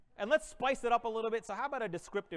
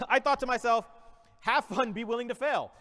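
louder, upbeat keynote delivery; mock-inspirational keynote voice; motivational speech; 5 semitones higher, 6 dB louder than usual. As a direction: address the crowd like a conference speaker.

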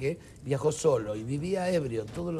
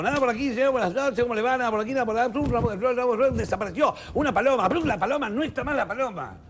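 lightly voiced stage magician, a bit higher; showman magician; 6 semitones higher, 3 dB louder, faster than usual. Magician doing patter for the drunk, lightly hammed. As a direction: patter like a ship's show magician. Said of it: "y el mago".

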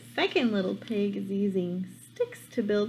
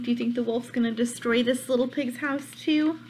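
ordinary delivery, nothing marked

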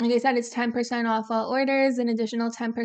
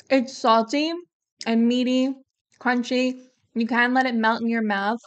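ordinary talk

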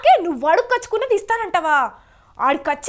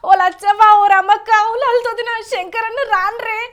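loud, raised cry to the neighbours; acted call for help, pushed louder; 3 semitones higher, 4 dB louder than usual. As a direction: raise the alarm about a thief. Calls neighbours against a thief.

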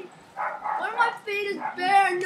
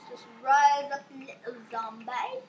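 coaxing; 7 semitones lower, slower than usual. Real, not acted. Coaxing his zombie.

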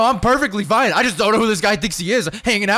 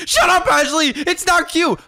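high-pitched cross retort; irritated; 7 semitones higher than usual. Acted. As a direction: shut the teasing friend down. Snaps at teasing friend.

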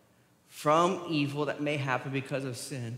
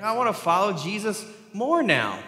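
higher, louder, ironic eagerness; mock excitement; 6 semitones higher, 5 dB louder than usual. Preacher voicing an unlikely eager follower after the warning, mock excitement. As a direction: play the over-keen new follower. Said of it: "Anybody excited for that?".

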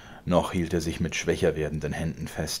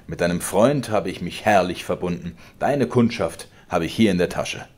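laut drohender alter Vater, höher und lauter; zorniger Vater; 2 semitones higher, 7 dB louder than usual. Acted. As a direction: schleudere ihm die Worte herrisch entgegen. Vater droht Georg. (der Vater droht dem Sohn, laut und herrisch)

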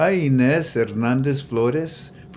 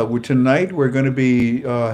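ordinary talk

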